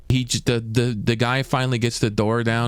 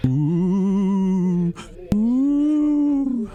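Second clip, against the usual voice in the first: high rising ghostly ooh; 8 semitones higher than usual. Shown as a demonstration. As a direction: moan like a cartoon ghost. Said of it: "like, the ghost voice".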